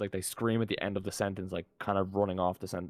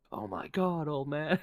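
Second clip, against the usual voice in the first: higher-pitched mock dub actor; bad dub acting; 6 semitones higher, slower than usual. Mocking a terrible Squid Game dub, hammed-up bad voice acting. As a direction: imitate an overdone English dub line. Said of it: "they're talking and then it's just like".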